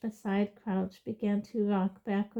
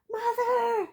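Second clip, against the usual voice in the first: high desperate scream. Acted. Boy screaming to his mother, high desperate. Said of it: "screamed the boy below".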